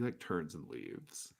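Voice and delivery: usual speaking voice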